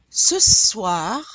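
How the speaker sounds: French "Ce soir" rising, suh-SWAR with a faint R; loud, rising "Ce soir" that ends on a breathy R; dry final R. Shown as a demonstration. Teacher has learners repeat, "Ce soir" with dry R. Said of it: "Yes, it's dry".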